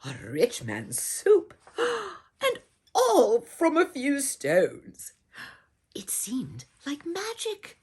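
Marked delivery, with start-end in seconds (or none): 1.7-5.1 slow, louder, higher wonder; breathless astonishment; amazed gasp. A narrator's reading. Marvelling storyteller. 5.9-7.8 hushed unvoiced whisper. A narrator's reading. Hushed storytelling aside.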